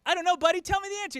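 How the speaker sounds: high-pitched indulgent grown-up; eager playing along. Comedian humors boy's riddle, high eager voice. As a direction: sing it up high for a kid.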